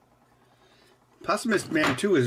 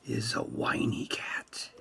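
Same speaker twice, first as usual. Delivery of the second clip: slow hushed whisper; slow, breathless whispered reveal; 3 semitones lower, 10 dB quieter than usual. Acted. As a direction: whisper the secret slowly.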